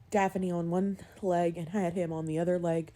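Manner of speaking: usual speaking voice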